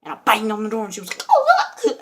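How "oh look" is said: very high, loud squeal; imitated startled girl. Girl startled by banging on door, imitated squeal. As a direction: squeal it in a mock girl's voice.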